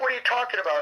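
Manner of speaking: high-pitched imitation of her disbelief; mock incredulous question; retold challenge. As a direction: mimic her questioning you, pitched well up. Retold argument, FBI leader challenges him.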